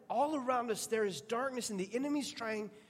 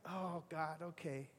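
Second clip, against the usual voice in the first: hushed aside; 3 semitones lower, 11 dB quieter, slower than usual. Retold or imitated. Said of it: "I was like".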